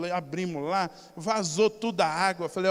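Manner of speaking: ordinary talk, nothing marked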